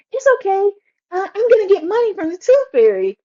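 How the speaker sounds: put-on high, loud happy kid voice; cheerful daughter. Daughter forgiving her brother, high and bright. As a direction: chirp it like a kid excited about money. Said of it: "she's like".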